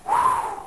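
long breathy exhale; airy, voiceless whew. Real, not acted. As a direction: blow out a breath, overwhelmed. Overwhelmed exhale after the retelling.

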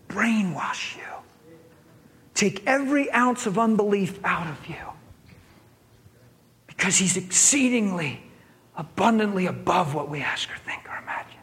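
intense preaching whisper. Real, not acted. Preacher urging the congregation in a whisper. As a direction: breathe it out as a hushed urging. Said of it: "urgent preaching whisper".

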